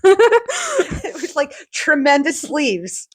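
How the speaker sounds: high, laughing, slowed; real laughter. Real, not acted. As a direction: giggle it out in delight. Laughing about the puffy sleeves.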